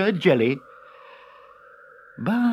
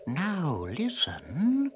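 soft, slow whisper; 7 dB quieter, much slower than usual. A narrator's reading. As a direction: hush them, slowly. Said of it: "whispered Zagan".

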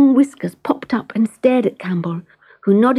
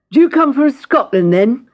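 a grin in the voice, pitched up and louder; grinning baker; 4 semitones higher, 5 dB louder than usual. Acted. Baker teasing the cat about Scotland, grinning. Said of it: "grinned the baker".

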